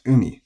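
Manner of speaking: "umi" high on "u", then drop on "mi"; head-high accent. Shown as a demonstration. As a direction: stress the first syllable of "umi" in pitch. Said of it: "umi is atamadaka".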